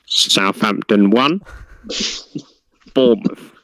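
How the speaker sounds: slow score reading with long pauses; football results announcer. Retold or imitated. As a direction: read it like the classified results. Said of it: "All right, I'll read 'em out".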